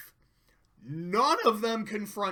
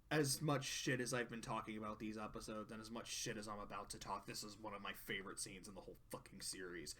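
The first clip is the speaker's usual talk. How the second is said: soft, quick confession; quiet aside; 12 semitones lower, 18 dB quieter, much faster than usual. Real, not acted. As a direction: say it under your breath fast.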